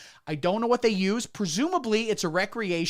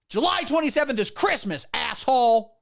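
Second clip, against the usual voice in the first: higher, louder retort ending on an insult; higher and louder, spitting out the last word; exasperated insistence; 5 semitones higher, 4 dB louder than usual. Retold or imitated. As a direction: shut him down hard. Dad snapping back at Santa.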